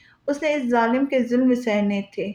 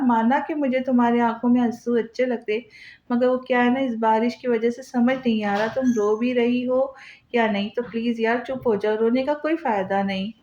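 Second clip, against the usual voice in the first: usual speaking voice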